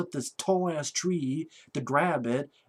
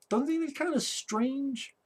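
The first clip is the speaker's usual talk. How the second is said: mock-puzzled question; 9 semitones higher, 4 dB quieter than usual. Real, not acted. Mocking rhetorical question to filmmakers.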